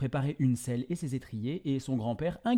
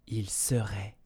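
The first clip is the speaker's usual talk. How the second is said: whispered; 4 semitones lower, 7 dB quieter than usual. A narrator's reading. Secret resolve at story end.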